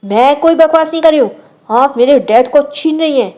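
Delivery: loud, high angry denial. A narrator's reading. Girl accusing her rival before the elders, loud anger. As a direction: glare and shout the accusation. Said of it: "गुस्से में घूरते हुए कहती है".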